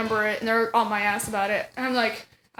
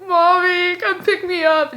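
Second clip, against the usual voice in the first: higher, mock-whiny plea to mom; tearful pleading; 6 semitones higher, 3 dB louder, slower than usual. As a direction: beg like a weepy little kid. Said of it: "I was just crying".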